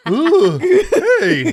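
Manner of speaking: squeaky falsetto ooh, hey; high, loud, laughing squeak; high squeaky startled hey. Retold or imitated. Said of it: "And I'm just like".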